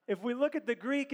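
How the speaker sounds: ordinary talk, nothing marked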